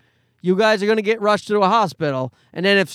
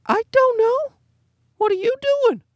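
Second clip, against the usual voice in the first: confused squeaky voice, pitched way up; dazed high squeak. Retold or imitated. To the nurse at six in the morning, a high dazed squeak. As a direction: play the clueless, sleep-deprived dad.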